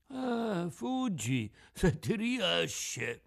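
high, slow, cracked fish voice; scoffing tuna. Acted. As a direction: dare the puppet drily, as a tuna.

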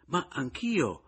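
high-pitched exasperated protest. A narrator's reading. Rival defends identifying the corpse, exasperated. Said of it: "esclamò Pomino esasperato".

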